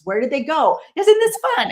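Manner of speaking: grandma at hide and seek, delighted; playful excitement. Retold or imitated. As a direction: squeal it like a game with a toddler. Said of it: "play hide and seek with your grandchild".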